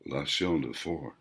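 soft, hushed voice from the reflection; eerie low whisper. A narrator's reading. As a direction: breathe the phrase quietly. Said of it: "A voice whispered".

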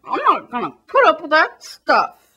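hammed-up outraged South Korean commentator; put-on South Korean voice. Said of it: "this is my South Korean voice".

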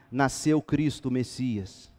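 ordinary talk

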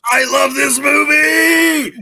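high, loud gushing cry; excited shout. Real, not acted. Co-host gushing about the film, high excited shout. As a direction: belt out your love for it.

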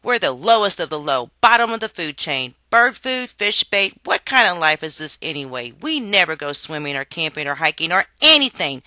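put-on loud, high, furious child's rant; angry outburst. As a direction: rage at the unfairness of worm life. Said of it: "exhausted by his outburst".

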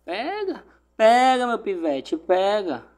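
yelled high and loud; excited shout. Real, not acted. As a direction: shout it at the screen. Torcendo no pênalti. (grito empolgado)

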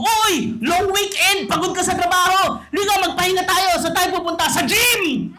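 high, loud hyped-up buddy; excited friend. Retold or imitated. A friend inviting you to the gym on a long weekend, high and loud. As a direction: pump it up like an eager gym friend.

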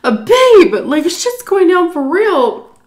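high, loud, slow warning to her husband; alarmed. Retold or imitated. Telling her husband in the kitchen, high and alarmed. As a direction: sound the alarm, drawn out. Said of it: "I'm like".

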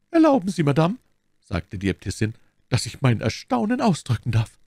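high, polite interjection from an abbess; astonished abbess. A narrator's reading. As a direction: lift the voice high in courteous surprise.

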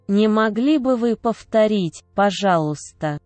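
ordinary talk, nothing marked

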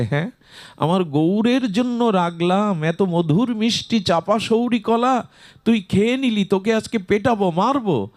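high-pitched mock scolding; acted scolding. Sita scolds little Krishnadas for eating Gaur's bananas, acted scolding. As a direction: voice an indignant mother threatening a beating. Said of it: "লাঠি তুলেছে".

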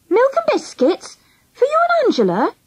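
high, questioning mum; puzzled checking. A narrator's reading. Mum checking over the mixer's noise, puzzled. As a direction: repeat the request high, as a question.

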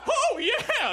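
high, loud laughing moan.

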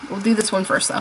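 normal talking voice

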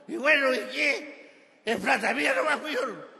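put-on high, loud defiant drunk; put-on drunk's indignant protest; drunk driver. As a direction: talk back to the cop as a drunk. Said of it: "Borracho bajó el vidrio".

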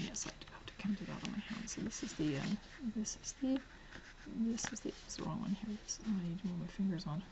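quick unvoiced muttering while counting; whisper. Real, not acted. Counting dates on her hand, in a fast whisper.

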